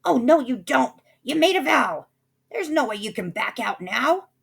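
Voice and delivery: high, loud indignant protest; indignant sister. A narrator's reading. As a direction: insist angrily on the promise. Sister insisting on the vow.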